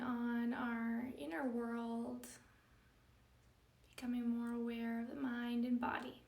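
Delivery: calm, measured yoga-teacher meditation delivery; guided meditation. Acted. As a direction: guide them gently into stillness, leaving space between phrases.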